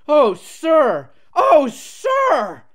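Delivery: high, loud squeals of delight; bubbling ecstasy. A narrator's reading. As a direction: bubble over with joy, very high. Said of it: "bubbled Peter Sam in ecstasy".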